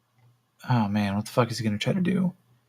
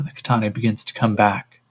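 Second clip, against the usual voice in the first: ordinary talk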